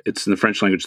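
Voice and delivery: ordinary talk, nothing marked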